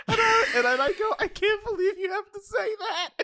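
high laughing, nearly crying; can barely speak for laughing; laughing through words. Real, not acted. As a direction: gasp the words out between laughs. Laughing fit at the worker.

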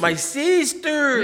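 slow, high "My sister" with a long R; hammed-up accent; mock Mexican accent. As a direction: drag out the R in "sister". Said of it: "He said that was racist".

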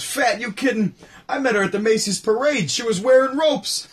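punching the joke like Rodney. Retold or imitated. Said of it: "And he goes".

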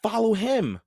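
high, loud urgent call. A narrator's reading. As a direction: cry out to chase the cat. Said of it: "cried Jack".

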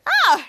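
high, loud mock yelp; short cry of acted alarm; startled yelp. Frankini startled out of his bag, mock yelp.

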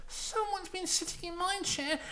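very high little bear voice; Baby Bear. Acted. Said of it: "Baby Bear goes".

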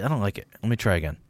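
ordinary talk

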